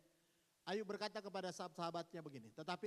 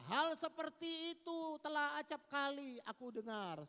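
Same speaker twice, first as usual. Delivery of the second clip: slow, loud, higher fed-up complaint; exasperated Job; 8 semitones higher, 7 dB louder than usual. Acted. Job fed up with his friends' talk. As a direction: let the weariness burst out. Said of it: "Dia berkata".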